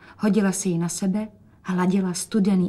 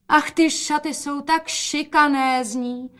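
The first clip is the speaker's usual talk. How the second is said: higher, slow delighted exclamation; exclaiming; 7 semitones higher, slower than usual. A narrator's reading. Mother exclaims in delight at the gown, higher and slow. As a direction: gush over the dress. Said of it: "zvolala matka".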